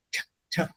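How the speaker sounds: unvoiced, isolated T, t; T, t as bare puffs of sound. Shown as a demonstration. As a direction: make only the sharp consonant of T, t. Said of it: "und mit T".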